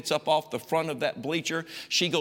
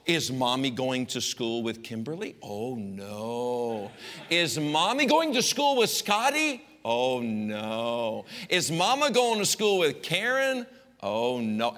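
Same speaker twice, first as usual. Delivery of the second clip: teasing sing-song; 3 semitones lower, slower than usual. Retold or imitated. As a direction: tease each child in turn. Mom teasing, up then down.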